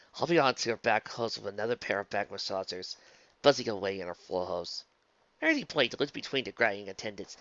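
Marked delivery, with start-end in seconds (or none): none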